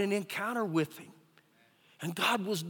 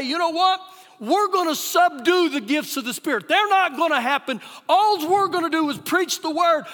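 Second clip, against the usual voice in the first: louder, higher, emphatic mock decree; rigid declaration; 4 semitones higher, 7 dB louder than usual. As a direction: voice the rigid crowd, forcefully. Voicing rigid church people's stance.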